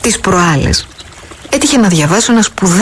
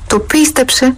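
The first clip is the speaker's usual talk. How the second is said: doubtful; 7 semitones higher than usual. Acted. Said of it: "είπε με αμφιβολία".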